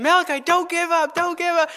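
high-pitched, pleading cheer-on; urgent encouragement. Retold or imitated. Father encouraging his son not to give up, retold urgently. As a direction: act out urging a child to keep going. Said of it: "I tried to encourage him".

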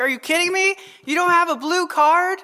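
high-pitched incredulous questioning; acted disbelief. Father questioning his daughter over her cards, retold with incredulity. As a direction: act out a baffled challenge.

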